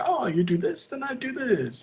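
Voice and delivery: high, teasing singsong as the gloating player; high mocking chess taunt. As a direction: lilt it up, taunting the loser. [name] mocking Clayton at chess.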